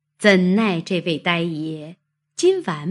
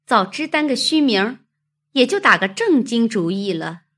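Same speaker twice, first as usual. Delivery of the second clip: quick, louder, higher-pitched maid's voice; 5 semitones higher, 4 dB louder, faster than usual. A narrator's reading. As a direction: recall her words briskly, pitched up. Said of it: "忽又想起晴雯说的". (晴雯快而高的女声)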